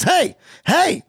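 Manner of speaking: high, sharp call; acted urgent shout for attention; calling after someone. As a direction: hail a man walking away. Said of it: "and he says".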